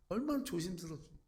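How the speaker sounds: ordinary talk, nothing marked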